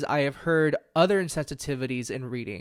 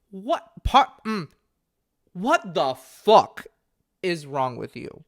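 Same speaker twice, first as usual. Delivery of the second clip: higher, louder, slow angry disbelief; angry outburst; 3 semitones higher, 5 dB louder, slower than usual. Real, not acted. Podcaster furious at a careless medium, exploding. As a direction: let the outrage burst out. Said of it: "because I'm so angry about it".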